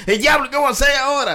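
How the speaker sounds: high-pitched, hammed-up alarm; mock panic. Blackmailed man reacting to the threat, mock panic. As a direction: play the trapped victim, pitch shooting up.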